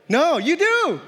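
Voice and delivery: high-pitched, emphatic rebuttal; emphatic insistence. Real, not acted. Preacher answering the congregation's doubt, emphatic insistence. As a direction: push back with a high, punchy insistence.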